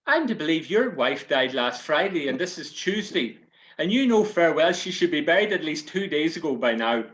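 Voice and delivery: loud, high scolding priest voice; indignant priest. Acted. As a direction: scold him like an outraged priest. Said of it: "says the priest".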